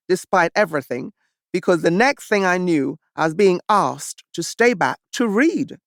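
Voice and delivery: plain reading voice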